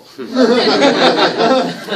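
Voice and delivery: big high burst of laughter; loud laugh. Real, not acted. Laughs after neighbor's retort.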